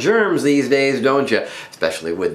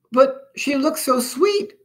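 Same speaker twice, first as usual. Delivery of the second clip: slow, very high, small voice; tender-hearted tiny germ; innocent little Pox; slower than usual. A narrator's reading. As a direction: plead softheartedly in a high little voice. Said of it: "said Pox".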